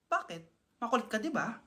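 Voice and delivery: low, soft, menacing. Acted. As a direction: drop the voice low and cold. Sketch character switch.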